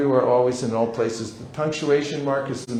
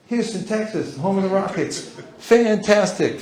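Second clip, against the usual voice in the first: mock delight; 6 semitones higher than usual. Shown as a demonstration. Demo praising stranger's hometown.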